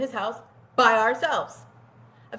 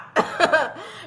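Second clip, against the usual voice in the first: a quick real laugh; brief laughter; short real laugh; 5 semitones higher than usual. Real, not acted. Laughing at own story.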